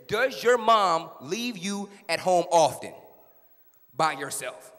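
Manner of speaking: slow, loud courtroom question; deliberate questioning. Acted. Attorney questions child on the stand, slow and emphatic. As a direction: space out each word firmly.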